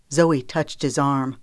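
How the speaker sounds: ordinary delivery, nothing marked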